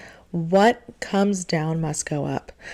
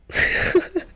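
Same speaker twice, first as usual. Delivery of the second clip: a breathy high giggle; 13 semitones higher than usual. Real, not acted. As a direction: let a quick giggle slip out. Laugh amid giddy thanks.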